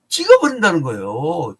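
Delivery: punched-out loud pronouncement; emphatic verdict. Real, not acted. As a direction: proclaim it forcefully, pitch sweeping down. Preacher proclaims the verdict, then repeats it.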